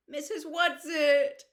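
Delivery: high, loud and drawn-out call; despairing call. Acted. Meg calling Mrs Whatsit in despair, high loud call. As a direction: cry out for rescue that won't come. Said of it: "Meg called despairingly".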